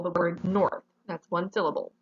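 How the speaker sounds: normal talking voice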